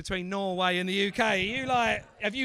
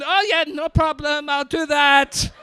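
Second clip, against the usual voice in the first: mock compliant reply; 6 semitones higher, 9 dB louder than usual. Voicing John's careless reply.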